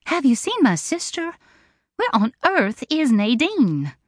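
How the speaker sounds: a nervous bride calling for her sister; Delane asking anxiously. A narrator's reading. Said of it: "and asked".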